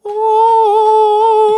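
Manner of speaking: a long high ooh of pleasure, loud; mock moan. Retelling the strip club dance, a high drawn-out ooh. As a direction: moan in delight. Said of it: "And I'm like".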